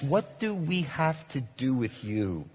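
quiet, measured paraphrase; demons' words retold. Paraphrasing the demons to Jesus, quietly. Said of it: "they're saying, in essence".

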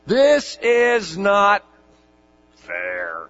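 hammed-up protest of a wronged laborer; grumbling workers. Laborers grumble at the master of the house, whiny and drawn out. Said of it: "That's what they're saying".

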